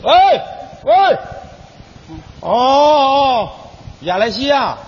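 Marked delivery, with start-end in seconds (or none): 0.0-3.5 phone call. Acted.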